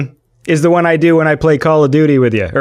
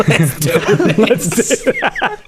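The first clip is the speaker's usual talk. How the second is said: mock Cockney; 6 semitones higher, slower than usual. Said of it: "that classic Cockney phrase".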